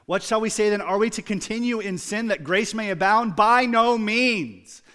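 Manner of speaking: loud, high, forceful scripture reading; emphatic reading. Acted. Preacher reading Paul to the congregation, loud and forceful. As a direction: proclaim it with full force.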